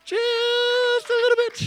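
"Just a little bit" very high, loud and slow; stretched squeaky. Shown as a demonstration. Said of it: "What about just a little wee snip?".

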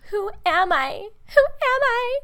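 high, searching, lost voice; confused self-questioning. Retold or imitated. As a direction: ask yourself, bewildered. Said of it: "we feel like".

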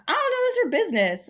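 high, loud, fast mock protest; quick, high-pitched retold dismissal; imitated brush-off. Dad brushing off nosy coworkers.

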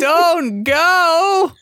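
high, loud drawn-out wail; hammed-up calling after someone running off; mock desperate plea. Mock plea to Harry continues.

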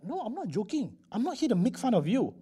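high-pitched, insistent denial; earnest protest. Acted. Rich buyer reassuring the doubting father, earnest protest. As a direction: insist you mean it, voice lifted high.

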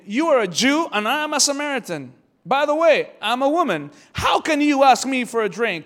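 high, loud, emphatic questioning; acted incredulous challenge. Samaritan woman questions Jesus asking for a drink, incredulous.

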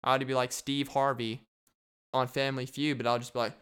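ordinary talk

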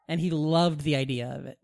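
usual speaking voice

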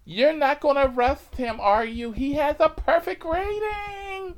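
very high, loud campy wail; dramatic protesting passenger. Retold or imitated. Passenger begging the cop not to arrest driver, shrill protest. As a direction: plead in a hammed-up falsetto shriek.

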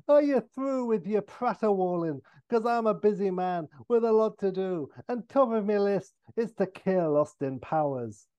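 high, threatening Irish rant; Irish accent. Acted. O'Brien announcing he will kill Austin, menacing Irish accent. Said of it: "he's like".

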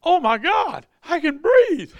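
high, loud astonished cry with a laugh; amazed relief. Retold or imitated. Healed woman exclaiming to the preacher, high astonished laughing cry. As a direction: burst out in disbelief that it worked. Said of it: "She goes, gasps".

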